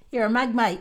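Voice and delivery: loud, punchy insult. Shown as a demonstration. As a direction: bark it at a mate. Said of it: "Means you're a fool".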